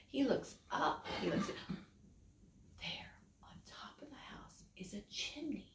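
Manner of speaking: spooky whisper. A narrator's reading. Storyteller building suspense for the children, whispered.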